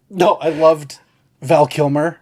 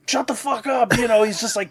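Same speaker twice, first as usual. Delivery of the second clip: high, put-on fed-up snap; exasperated officer; 8 semitones higher than usual. Ryan Phillippe snapping at MacGruber, in a high fed-up voice. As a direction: cut him off, disgusted. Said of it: "Ryan Phillippe is just like".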